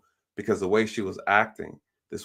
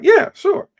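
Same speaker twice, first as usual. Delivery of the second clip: much higher, louder squeak of a yes; squeaky eager yes; 11 dB louder than usual. Retold or imitated. Officer gladly agrees to let her pray, squeaky high yes. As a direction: pop the yes way up like an eager kid.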